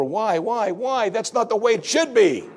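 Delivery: high, pleading, protesting cry of why; anguished complaint, pitched well above the preacher's voice; despairing why. Retold or imitated. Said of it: "by saying over and over".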